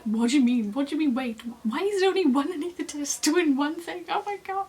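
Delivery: indignant, rising disbelief; beside herself. Retold or imitated. Patient told to wait for the anaesthetist, outraged retold protest. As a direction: build from loud protest to high exasperation. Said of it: "beside myself".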